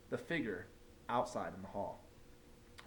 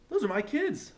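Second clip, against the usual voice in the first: much higher, louder scratchy shout; 13 semitones higher, 7 dB louder than usual. A narrator's reading. Teacher spotting her class outside, scratchy indignant cry. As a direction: screech it out indignant. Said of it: "in a scratchy voice".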